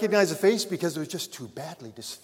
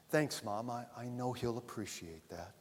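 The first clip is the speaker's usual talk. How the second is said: softer, broken-voiced thanks; choking back tears; 2 semitones lower, 10 dB quieter than usual. A narrator's reading. Son on the phone thanking his mother, choked up. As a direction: hold the tears back as you thank her. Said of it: "through a broken voice, choking back his tears".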